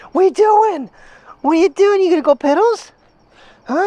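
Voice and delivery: high and loud sing-song to the puppy. Real, not acted. Owner asking his dog to pee, high loud baby talk.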